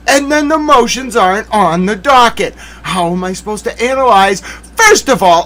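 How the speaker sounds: fed-up, emphatic protest; exasperated rant. Real, not acted. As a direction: argue it like you're at your limit. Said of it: "push Towel to a certain limit".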